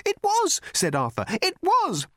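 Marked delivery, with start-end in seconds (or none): 0.0-0.6 high insistent. Acted. Arthur insists nervously. 1.3-2.1 high insistent. Acted. Arthur repeats insistently.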